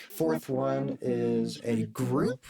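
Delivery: layered octave up and down voice; octave group effect. Shown as a demonstration. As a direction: voice with octaves above and below. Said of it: "an octave up and an octave down".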